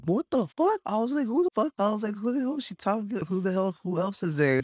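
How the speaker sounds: high-pitched, incredulous demands; outraged disbelief. Retold or imitated. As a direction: act out stunned outrage at a rival turning up. Retells reacting to rival's text.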